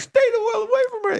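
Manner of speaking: very high, loud, hurried fake wail; hammed-up tearful outburst; mock crying. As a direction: squeal it out like a sobbing boy, half laughing. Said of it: "like, crying in the classroom".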